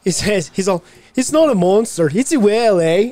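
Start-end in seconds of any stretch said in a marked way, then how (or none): 1.2-3.1 high, loud mock Irish accent. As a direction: put on a thick Irish lilt. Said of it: "my daughter, just like that".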